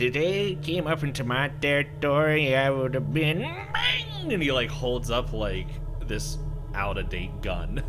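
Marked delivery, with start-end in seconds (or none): 0.0-4.3 put-on old codger blustering, pitch climbing; blustering old man character. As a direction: play a boastful old man scolding the youngsters.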